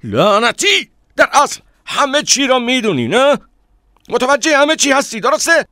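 very high, loud, enraged yelling; furious shout. A narrator's reading. Man yelling that the narrator knows everything, furiously. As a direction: scream it at them, angrier still. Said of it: "فریاد زد".

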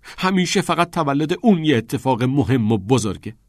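high, loud resentful complaint; angry. A narrator's reading. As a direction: complain heatedly about being overlooked. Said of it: "با عصبانیت گفت".

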